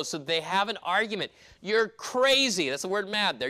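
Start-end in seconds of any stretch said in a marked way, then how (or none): 1.6-2.7 scoffing. Retold or imitated. The church arguing with Rhoda.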